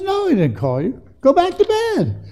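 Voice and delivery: put-on high, sharper old-man voice; old Eli waving Samuel back to bed with a high sharp voice; exasperated Eli. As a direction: shoo the boy back to bed. Eli sends Samuel back again.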